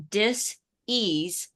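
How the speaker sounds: "dis-ease" said as two words, a pause before ease; dis-ease, slow and separated; split dis-ease. Shown as a demonstration. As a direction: break "dis-ease" apart, stressing ease. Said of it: "that's the word disease".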